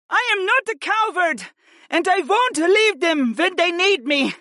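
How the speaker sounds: very high, impassioned protest; defiant shout. A narrator's reading. Warrior princess to her family, shouting in protest. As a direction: shout back with fierce resolve, pitched high. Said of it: "Freya shouted once more".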